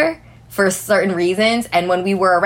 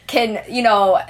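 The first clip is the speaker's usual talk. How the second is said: ordinary talk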